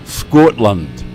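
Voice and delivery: a proud Scottish brogue; hammed-up Scottish accent, proclaimed. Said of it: "It's the only accent I can basically do".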